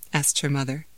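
ordinary delivery

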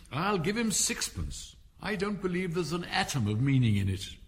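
put-on high, emphatic girl's challenge; bold Alice. A narrator's reading. Alice boldly interrupts the King.

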